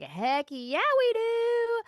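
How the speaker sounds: high, whooping yes, drawn out at the end; excited acceptance. Retold or imitated. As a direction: re-enact an elated yes, pitch soaring. Acting out excited acceptance of invitation.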